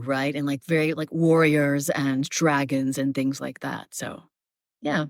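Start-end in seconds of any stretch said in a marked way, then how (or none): 0.9-3.9 low, slowing warrior register; really low. Shown as a demonstration. Voice actor listing roles she plays, really low. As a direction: keep it down deep, for warriors and dragons. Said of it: "I play really low".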